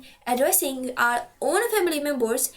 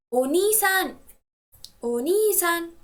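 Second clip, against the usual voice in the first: "oni-san" with ni drawn out and higher; stress on ni; 3 semitones higher, slower than usual. Shown as a demonstration. Teacher modelling Japanese older brother word, stress on "ni" in "oni-san". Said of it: "stress the syllable ni".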